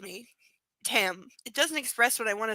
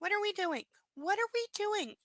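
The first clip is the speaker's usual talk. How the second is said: much higher mocking whine; higher, mocking whiny repeat; 12 semitones higher, 3 dB quieter than usual. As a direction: whine it like a baby.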